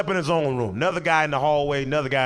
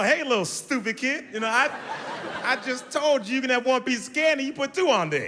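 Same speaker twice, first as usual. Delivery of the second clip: laughing scold; 9 semitones higher than usual. Retold or imitated. Scolding kid for two Snickers.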